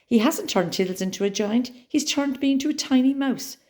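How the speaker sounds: high squeaky panic; high terrified mouse squeak. A narrator's reading. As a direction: squeak it out in terror. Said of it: "I squeaked in terror".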